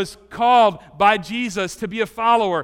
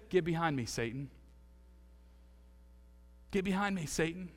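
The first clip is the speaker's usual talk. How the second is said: quiet rebuke; 3 semitones lower, 15 dB quieter than usual. Pretend. Jesus rebuking Peter.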